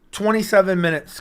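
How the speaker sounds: usual speaking voice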